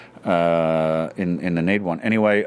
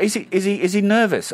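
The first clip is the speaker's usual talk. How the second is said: high, stammering imitation of her fretting; worried co-star; 10 semitones higher than usual. Co-star asking about a masked colleague, mock-anxious. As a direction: mimic her repeated anxious question, pitched up. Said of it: "She said".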